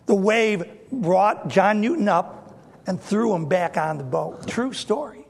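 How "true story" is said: usual speaking voice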